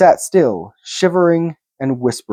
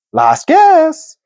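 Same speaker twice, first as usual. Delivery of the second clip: high, louder warning; urgent call; 4 dB louder than usual. A narrator's reading. Bilbo warning Gollum of his last guess. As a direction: press him for the last try.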